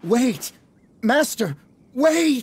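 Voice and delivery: frantic shout for the master to stop; desperate calling out. Acted. Calling after departing master.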